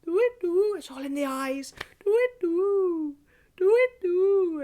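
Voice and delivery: high hooting owl call. Shown as a demonstration. Leader calling twit-twoo high, like an owl. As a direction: hoot it high, like an owl at night. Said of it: "let's give it a full twit to a twit".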